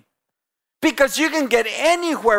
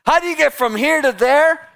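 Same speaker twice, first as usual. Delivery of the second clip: mock-puzzled question, higher and rising; sarcastic; 7 semitones higher, 4 dB louder than usual. Shown as a demonstration. Preacher showing the congregation a sarcastic question. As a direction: sound sarcastically clueless. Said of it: "I do mean to be a little bit sarcastic".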